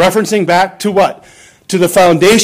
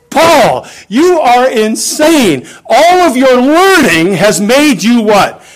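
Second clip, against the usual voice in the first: raised, slow mock yell at Paul; higher, louder accusing shout, dragged out; Festus yelling; 6 semitones higher, 3 dB louder, slower than usual. Festus shouting at Paul through a megaphone, raised and slow. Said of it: "he's yelling at Paul".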